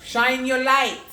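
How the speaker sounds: loud, high rallying call; loud call to shine. Acted. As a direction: belt it out like a rallying cry. Urging encouragement.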